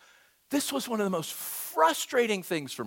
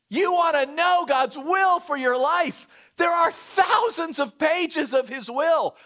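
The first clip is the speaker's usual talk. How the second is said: railing rebuke; 6 semitones higher, 5 dB louder than usual. Retold or imitated. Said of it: "he starts railing on them".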